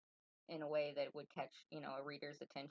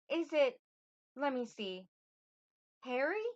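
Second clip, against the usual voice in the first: put-on high, halting young lady's voice; young queen, feminine; 9 semitones higher, 10 dB louder than usual. Queen pretends to search for a name, feminine questioning voice. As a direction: pause to think, then lift the guess high. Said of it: "soft-spoken and".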